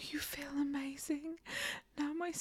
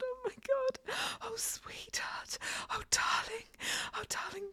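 rising excitement; 8 semitones higher, faster than usual. Acted. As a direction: let it build to a breathless peak. Building to climax.

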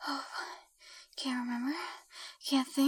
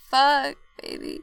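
loud breathless laugh; 5 semitones higher, 16 dB louder, slower than usual. Acted. Climactic loud laugh and exclamation.

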